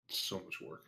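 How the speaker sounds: breathy sigh, barely voiced. Real, not acted. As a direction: exhale the words, weary. Asked to make eating noises.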